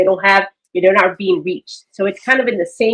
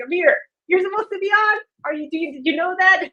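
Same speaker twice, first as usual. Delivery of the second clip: frantic; 11 semitones higher than usual. Retold or imitated. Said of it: "I was like".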